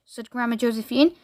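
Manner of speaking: ordinary delivery, nothing marked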